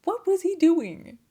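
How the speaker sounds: high, loud, slow disbelief; what was he even doing, drawn out high; incredulous. Real, not acted. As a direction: stretch it out in total disbelief. Teller incredulous at two-year trip.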